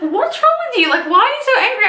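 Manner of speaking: high-pitched mock-puzzled friend voice; high, incredulous questioning in imitation; imitating baffled friend. Voicing her baffled friend's questions.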